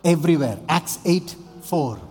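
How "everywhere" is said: slow, high, louder list of places; preaching. Acted. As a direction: build each place into a call. Preacher listing places, rising.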